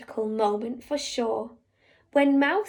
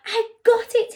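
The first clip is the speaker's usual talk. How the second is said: excited little mouse; 8 semitones higher, 6 dB louder than usual. Acted. Mouse jumps up with idea.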